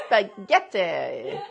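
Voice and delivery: put-on slow, loud, laughing Italian accent. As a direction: Spaghetti drawn out with a laugh. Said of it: "I need to practice my Italian accent".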